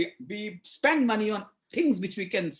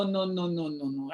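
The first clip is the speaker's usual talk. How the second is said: ordinary talk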